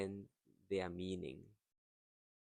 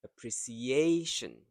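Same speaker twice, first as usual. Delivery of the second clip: "appreciation" louder, with a long stressed "a" before "tion"; same stress pattern; 2 semitones higher, 7 dB louder than usual. Shown as a demonstration. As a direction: hit and stretch "A" in appreci-A-tion. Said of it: "The same as this".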